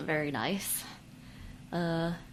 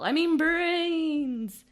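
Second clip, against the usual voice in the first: zombie voice; 9 semitones higher, 5 dB louder, much slower than usual. Acted. Zombie joke.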